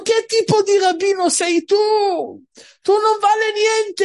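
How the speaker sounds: high, loud furious outburst at the rabbi; angry butcher. Acted. Butcher yells at the rabbi who ruled against him, outraged. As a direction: shout him down like a cheated man. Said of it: "il macellaio si è arrabbiato".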